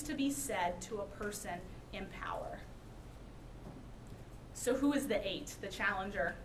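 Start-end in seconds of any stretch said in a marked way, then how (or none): 0.0-2.6 hushed, unvoiced aside; whisper. Real, not acted. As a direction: lean in and whisper it.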